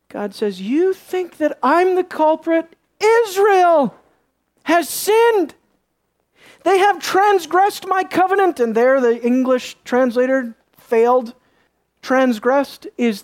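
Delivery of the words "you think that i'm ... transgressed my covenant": high, declaimed, with weighty pauses; indignant divine rebuke. Acted. God answering Joshua who blames him, indignant rebuke. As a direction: hurl back the charge in a raised, emphatic pitch.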